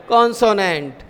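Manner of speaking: ordinary talk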